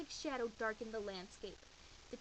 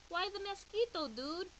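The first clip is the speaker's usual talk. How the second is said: higher, louder little voice; a hint of a squeaky small dog; 7 semitones higher, 4 dB louder than usual. Smallest chihuahua asking the hero, lightly squeaky.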